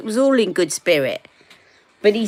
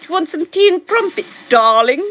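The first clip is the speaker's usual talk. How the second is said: much higher, laughing posh toff voice; posh mock British; 10 semitones higher, 4 dB louder than usual. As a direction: overplay the plummy Brit offering tea. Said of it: "There you go".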